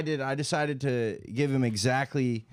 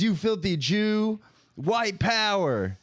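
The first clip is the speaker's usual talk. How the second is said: mock angry troll; 6 semitones higher, slower than usual. Troll's reply voiced.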